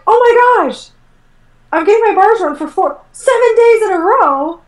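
high, squealing delight, stretched at the end; thrilled exclaim. Retold or imitated. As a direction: gush like you can't believe your luck. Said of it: "And I was like".

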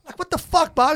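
high-pitched indignant swearing; mock exasperated cursing. Angry comic to booker, mock exasperated cursing.

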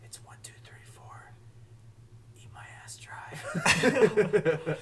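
whispered, very slow, cracking up; laughing whisper. Pretend. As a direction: spill a fake secret address in a whisper while laughing. Whispering a fake secret address.

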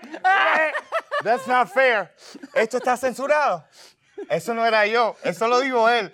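hammed-up cry of unfairness; mock protest. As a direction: protest your innocence, playing it up. Mock protest after being tricked.

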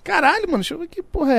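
high surprised outburst. Real, not acted. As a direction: jump at the sudden phone call. Phone rings mid-talk. (surpreso com o telefone tocando, agudo)

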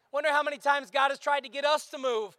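ordinary talk

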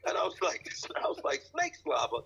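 laughing through a shocked retold what; cracking up in disbelief at mom's answer. Son retells hearing mom's snake story, laughing in disbelief.

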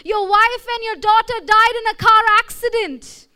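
loud, high call across the office; a passerby shouting bad news after him. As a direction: yell out to him from a distance. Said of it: "someone shouts and says".

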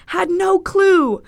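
slow, loud, high wail; aghast emphasis. Real, not acted. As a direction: stretch each word in loud disbelief. Aghast at own blackout.

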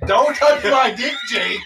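laughing shout. Real, not acted. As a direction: yell it through laughter. Shouted joke at friend.

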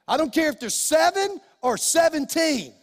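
usual speaking voice

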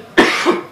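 sharp loud cough; real coughing fit, loud. Real, not acted. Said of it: "sorry ya, gua batuk-batuk".

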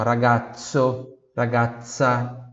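ragazzo and ragazza with sharp ts, ra-GAT-tso; Italian ZZ as ts. Shown as a demonstration. Teacher contrasts ragazzo's ts for students, clear and slow. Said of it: "som de T S".

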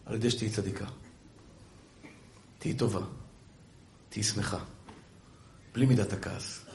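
soft, low, earnest counsel; hushed low blessing with long pauses; tender fatherly appeal. Real, not acted. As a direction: plead gently as to a daughter.

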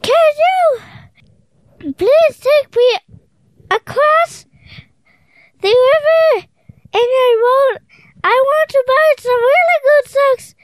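put-on high, pleading little girl voice. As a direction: beg sweetly in a high voice. Child voicing Tina in story.

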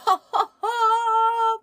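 squealing high burst of laughter. Real, not acted. Vlogger laughing at Saoirse's line about her mother, high squeal.

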